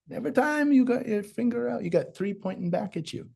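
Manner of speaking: lightly voiced old saying; quoting grandma. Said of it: "my Omi used to say this".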